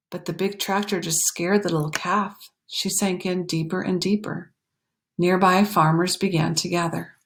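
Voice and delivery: plain reading voice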